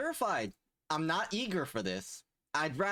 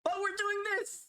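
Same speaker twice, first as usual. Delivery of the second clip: very high, squeaky announcement; squeaky hype. Pretend. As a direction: squeal it with forced cheer. Mock-cheery announcement.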